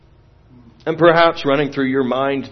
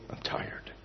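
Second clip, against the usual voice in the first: "I'm tired" whispered, trailing away to nothing; fading whisper; 22 dB quieter than usual. Retold or imitated. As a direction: let "I'm tired" fade into a breath. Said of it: "He just repeated it".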